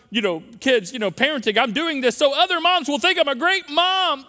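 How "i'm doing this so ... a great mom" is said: mock approval-seeking mom. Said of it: "when you find yourself moms".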